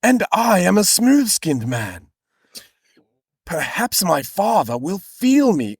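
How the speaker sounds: hammed-up earnest Bible character; Jacob's character voice. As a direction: draw it out in a high, stagey voice. Voices Jacob's worry to his mother.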